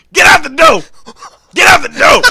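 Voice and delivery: high, loud repeated yell; shouted command. Retold or imitated. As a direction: bellow it at the doors. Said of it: "he was just going".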